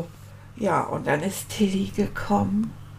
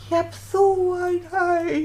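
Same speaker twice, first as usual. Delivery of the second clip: higher, wailing homesick cry; breaking into tears; 10 semitones higher than usual. Retold or imitated. Homesick boy breaks down crying in front of Paul, wailing. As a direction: let the voice crack into a wail.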